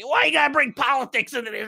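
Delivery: high, loud Philly complaint; Philly accent. Acted. Fan objecting to politics in the episode, Philly accent. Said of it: "we did Philly accent for this too".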